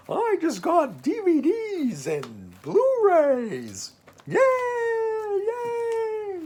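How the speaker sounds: put-on high, loud, slow kids' show cheer; sing-song cartoon excitement; cartoon character voice. Shown as a demonstration. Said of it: "like a cartoon character".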